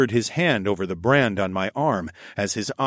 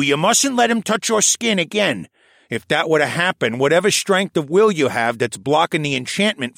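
put-on raised, lively old scholar voice; old koala elder voice; 6 semitones higher, 4 dB louder than usual. A narrator's reading. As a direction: voice the old archivist, a touch higher. Tovish warns.

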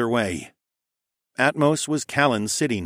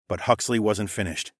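ordinary delivery, nothing marked

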